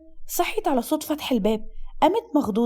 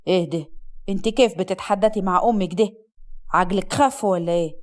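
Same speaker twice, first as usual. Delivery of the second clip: low-pitched, reproachful husband; indignant husband; 6 semitones lower than usual. Acted. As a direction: scold her in a deep, indignant male voice.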